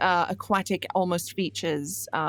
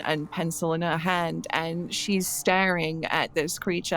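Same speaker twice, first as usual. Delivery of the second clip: usual speaking voice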